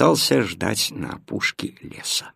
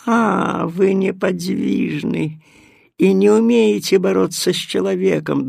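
sly cat; 7 semitones higher, 3 dB louder than usual. Acted. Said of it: "догадалась кошка".

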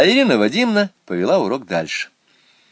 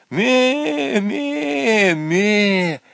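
very high "ме, ме, ме" bleats; kid's bleat; 12 semitones higher, much slower than usual. A narrator's reading. Hidden kid keeps bleating from under the porch. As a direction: keep bleating like a tiny kid. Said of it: "донеслось".

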